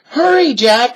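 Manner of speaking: cried. A narrator's reading. Sister urges Jack to hurry, loud cry. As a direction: hurry him along. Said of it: "cried Annie".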